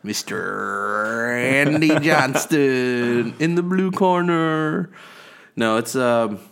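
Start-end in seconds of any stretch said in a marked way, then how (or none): 0.0-4.9 slow, drawn-out ring announcer call; boxing announcer. Acted. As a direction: announce him like a prize fighter. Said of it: "I rolled the R there".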